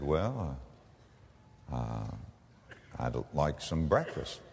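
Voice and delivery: meek reply. Retold or imitated. Meek customer answers waitress.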